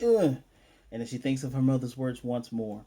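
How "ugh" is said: high, loud mock groan; short, loud, higher-pitched ugh; acted ugh. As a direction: imitate a cornered kid's exasperated ugh. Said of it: "And she's like".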